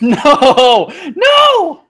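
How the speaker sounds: loud, very high yell; anguished scream. Real, not acted. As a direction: scream at the ball in despair. Ball rolling backwards.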